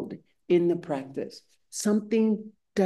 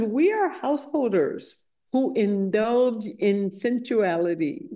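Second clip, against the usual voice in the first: emphatic, higher, animated recitation; scripture quote read with relish; 4 semitones higher than usual. Acted. Householder tells the Buddha why renunciation daunts them, emphatic and high. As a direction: read it out grandly, savoring each phrase.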